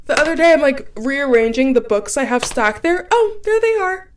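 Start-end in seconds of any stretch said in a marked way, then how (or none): 3.1-4.1 high, put-on little voice of discovery; mock surprised discovery. Finding lost keys behind books, mock surprise. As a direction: act out spotting them, pitched way up.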